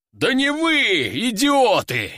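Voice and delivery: high, drawn-out angry yell; exasperated roar. A narrator's reading. General correcting recruits who obeyed, exasperated roar. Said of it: "взревел генерал".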